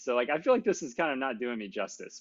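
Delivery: usual speaking voice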